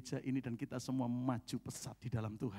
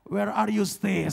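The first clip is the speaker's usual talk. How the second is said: pointed question; 7 semitones higher, 12 dB louder, slower than usual. Retold or imitated. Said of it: "Itu intonasinya persis seperti yang lalu".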